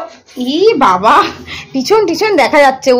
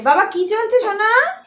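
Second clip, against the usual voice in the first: আদুরে প্রশ্ন; 9 semitones higher, 4 dB quieter than usual. Real, not acted. Cooing to just-woken small child. (বাচ্চাকে আদর করে জিজ্ঞেস)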